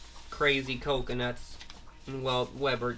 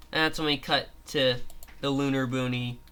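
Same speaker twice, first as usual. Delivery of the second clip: ordinary delivery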